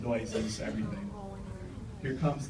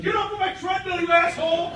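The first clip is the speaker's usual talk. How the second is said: high-pitched, outraged screech as a mimicked woman; mock an indignant woman far above your own pitch; 13 semitones higher than usual. Acted woman catching him behind her.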